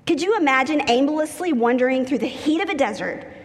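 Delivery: usual speaking voice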